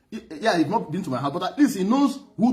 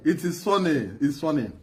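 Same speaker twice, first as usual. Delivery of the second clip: ordinary talk, nothing marked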